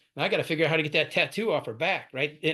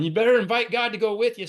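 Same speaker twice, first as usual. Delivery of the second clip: loud, higher yell over a speakerphone; retold yell; 6 semitones higher, 5 dB louder than usual. Sponsor's husband shouting advice from the background. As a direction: bellow it from across the room. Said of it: "I could hear her husband on the speakerphone yelling".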